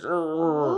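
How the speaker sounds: high urgh in a put-on voice; a cartoon grumble; grumpy groan. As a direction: groan like the picture. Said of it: "It's like".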